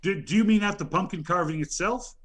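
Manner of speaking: usual speaking voice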